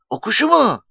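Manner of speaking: put-on very high, innocent little-boy voice; naive, scared question. A narrator's reading. Frightened Nuri answers Mustafa's threat, naive question. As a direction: play dumb in a high, timid pitch. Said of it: "diye safça sordu Nuri, korkmuştu".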